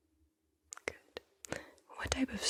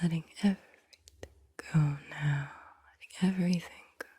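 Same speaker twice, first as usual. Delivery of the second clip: usual speaking voice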